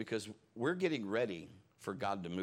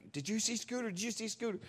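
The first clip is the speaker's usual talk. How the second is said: high, eager schoolkid chatter; excited gossip; 12 semitones higher than usual. Retold or imitated. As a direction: mimic the buzz of excited classmates. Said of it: "everybody's like".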